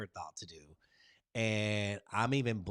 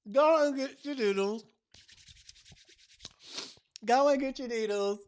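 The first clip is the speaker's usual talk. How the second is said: high, loud, hyped-up farewell; excited sign-off; 13 semitones higher, 11 dB louder than usual. Real, not acted. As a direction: call out to the whole crowd with love.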